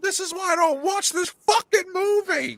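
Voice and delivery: high, loud mock yell at the screen; retold yell. Watching Endgame again, yelling at the tears, loud. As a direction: yell it out like the movie got you. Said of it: "I literally yelled out loud".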